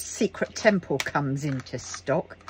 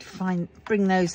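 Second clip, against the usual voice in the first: ordinary talk, nothing marked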